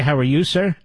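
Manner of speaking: ordinary delivery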